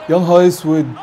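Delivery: loud dismayed outburst; loud stunned dismay; shocked exclamation. Real, not acted. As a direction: blurt it out in disbelief. Shocked at the game.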